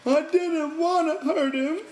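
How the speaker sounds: high, slow tearful whine, stretching wanna; crying. A narrator's reading. Lenny cries to the men he didn't mean harm, tearful. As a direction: sob the whole line like a guilty child. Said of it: "Lenny cried".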